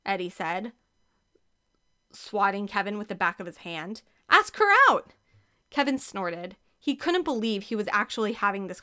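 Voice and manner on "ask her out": loud, much higher shout; urging shout. Acted. Eddie urging Kevin to ask her out, loud shout. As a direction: shout it at him, pushing hard.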